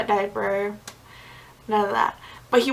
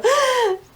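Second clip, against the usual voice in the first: sharp high gasp for breath after holding it; gasp for air; 13 semitones higher than usual. Retold or imitated. Telling about labor, she acts out her sharp high gasp for air. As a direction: gulp in air like after a long push. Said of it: "like, six and I'd go".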